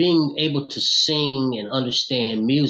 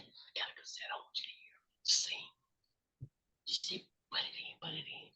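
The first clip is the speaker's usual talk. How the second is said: whisper; 18 dB quieter than usual. Retold or imitated. Whispering aside during the call.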